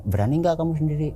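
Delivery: high-pitched imitated boss's question. Boss voiced asking if he dares.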